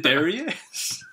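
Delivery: high, loud, slow dramatic announcement; mock reveal. Podcast host jokingly announces Mark's arrival, hammed-up. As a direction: announce him like a big entrance.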